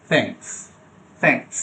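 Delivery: thanks with a soft, light th. Shown as a demonstration. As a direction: model thanks gently. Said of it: "softly উচ্চারণ হবে".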